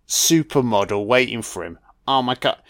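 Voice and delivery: ordinary talk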